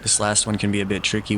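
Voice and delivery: normal talking voice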